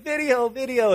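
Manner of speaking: put-on high, pleading little-kid whine; whining toddler. Father imitating his toddler demanding a video, high whine. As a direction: mimic a toddler begging for the video. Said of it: "starts whining".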